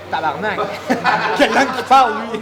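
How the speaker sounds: laughing exclamation. Retold or imitated. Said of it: "t'es genre".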